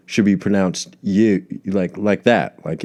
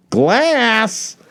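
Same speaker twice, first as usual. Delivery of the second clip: glass shout. Retold or imitated. Quoting the glass scene.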